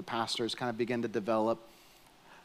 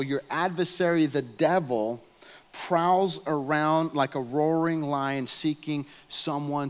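full-force preaching; 5 semitones higher, 5 dB louder than usual. Acted. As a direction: preach it hard, driving home the warning.

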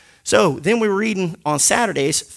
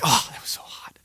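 hushed imitated whisper; 6 semitones lower, 6 dB quieter than usual. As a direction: breathe it like a guilty secret. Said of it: "He's like".

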